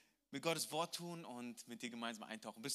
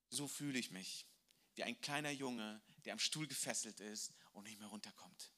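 softer and lower, a touch of sorrow; sad confession; 6 semitones lower, 7 dB quieter than usual. Acted. Drunk father confides in the missionary, hushed and sad. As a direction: let it sink, hushed and beaten.

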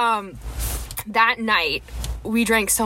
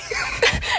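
breathy laugh. Real, not acted. Breathy laugh after quote.

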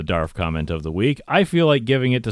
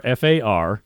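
usual speaking voice

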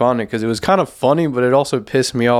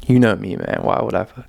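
ordinary talk

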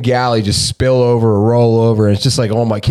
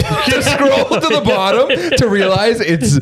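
high laughing mockery; 6 semitones higher, slower than usual. Real, not acted. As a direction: laugh through the words. Mocking recipe blog readers.

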